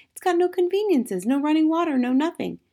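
high, emphatic protest from a farmer; exclaiming farmer. A narrator's reading. Farmer warning about his cottage, crying out in protest. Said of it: "he cried".

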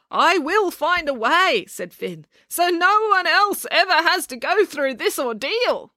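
high, slow, heroic pledge; solemn vow. Acted. Scene: Finn vowing to spare others his ordeal, high and slow. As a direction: vow it like a wounded hero.

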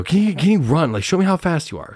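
high-pitched teacher encouragement; egging a kid on. Retold or imitated. Teacher daring boy to run fast, high encouraging voice.

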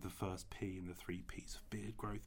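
hushed mock-wise whisper. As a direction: whisper it like a guru. Mock wise beard guru whisper.

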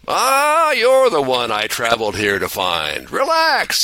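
put-on a cheery old angel greeting George; jolly old angel. Clarence the angel speaking.

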